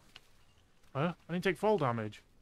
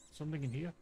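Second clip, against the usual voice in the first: ordinary delivery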